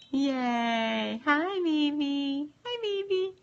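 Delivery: high, slow cooing. Real, not acted. Cooing at her baby.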